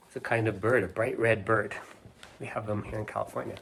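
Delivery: plain reading voice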